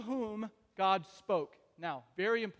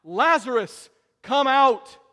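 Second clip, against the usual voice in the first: loud, higher-pitched commanding shout; loud cry; 3 semitones higher, 10 dB louder than usual. Retold or imitated. Jesus calling dead Lazarus out of the tomb, loud cry. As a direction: cry it out at full voice. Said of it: "in a loud voice ... in a shrieking voice".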